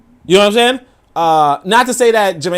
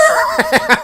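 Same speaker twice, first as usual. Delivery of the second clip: a squealing high laugh breaks out; high-pitched laugh. Real, not acted. Laughing at his own joke about dating, high squeal. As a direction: burst into a high giggle.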